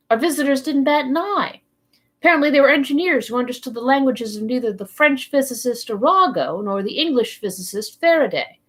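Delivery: ordinary delivery, nothing marked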